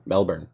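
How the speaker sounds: "Melbourne" as MEL-bn, soft ending; "Melbourne" with the r dropped, MEL-bun; "Melbourne" said the local way, MEL-bun; Melbourne said Mel-burn. Shown as a demonstration. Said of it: "I don't know how you say it".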